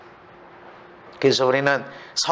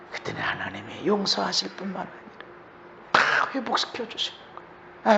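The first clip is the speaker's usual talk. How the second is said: usual speaking voice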